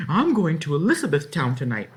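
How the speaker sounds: insist, a little frantic; anxious girl protesting. A narrator's reading. Disguised girl insists she must travel tonight, urgent.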